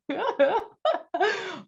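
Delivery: high, bubbling real laugh; a high burst of giggling; delighted laugh. Real, not acted. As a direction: laugh out in happy disbelief. Laughing at being mistaken for Chilean.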